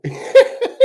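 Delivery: real breathy burst of laughter; high laugh. Real, not acted. High burst of laughter. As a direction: break into a high laugh while telling a friend about the chat.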